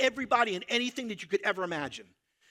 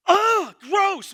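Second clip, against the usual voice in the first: higher and louder, grossed out; loud disgusted cry; 11 semitones higher, 10 dB louder than usual. Acted. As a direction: blurt out pure disgust. Reacting with disgust.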